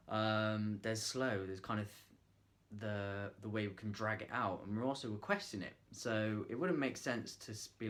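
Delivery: ordinary delivery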